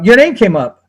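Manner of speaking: normal talking voice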